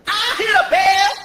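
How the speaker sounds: annoyed night clerk. Retold or imitated. Night clerk yells from the back, slow mock shout. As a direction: bellow from the back room. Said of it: "I heard this".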